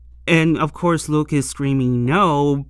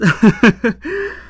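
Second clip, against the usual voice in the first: a high loud laugh; loud burst of laughter; 7 semitones higher, 7 dB louder than usual. Real, not acted. Laughing as the story gets worse. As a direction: crack up at how bad it gets.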